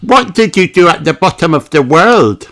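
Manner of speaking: put-on curious little girl asking; Peppa asks. A narrator's reading. Peppa asking Captain Dog about his trip, high curious voice. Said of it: "asks Peppa".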